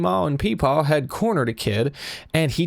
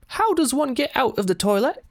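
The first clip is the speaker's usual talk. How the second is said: high, hammed-up British voice; very British accent; 10 semitones higher than usual. Knocking on an occupied bathroom stall, terrible high British accent. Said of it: "accompanied by a very British".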